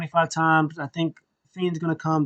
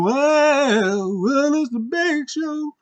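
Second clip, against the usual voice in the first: high, slow drawn-out announcement; hammed-up theme intro; mock entrance theme; 11 semitones higher, much slower than usual. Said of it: "And then".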